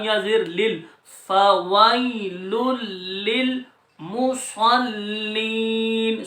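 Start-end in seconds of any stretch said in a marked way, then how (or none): 1.3-2.5 slow melodic tajweed chant; Quran recitation. Shown as a demonstration. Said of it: "صاد کو لام میں ملائیں گے". 3.2-6.2 للمصلین drawn out slowly with doubled lam; Quran recitation. Shown as a demonstration. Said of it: "صاد کو لام میں ملائیں گے".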